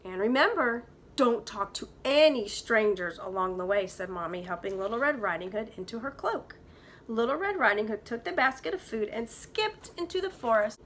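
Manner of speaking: put-on lively read-aloud for kids; story narration. A narrator's reading. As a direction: tell it like a bedtime storybook. Teacher reads story aloud.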